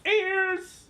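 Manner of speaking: rising shouted ears. Real, not acted. Shouting word rising in pitch.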